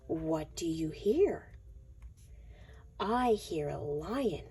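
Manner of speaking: ordinary delivery, nothing marked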